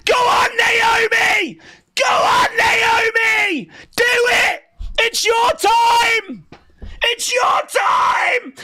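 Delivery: real, hoarse-edged fan screaming; screaming celebration. Real, not acted. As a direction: lose it with joy as she wins. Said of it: "That's my little celebration".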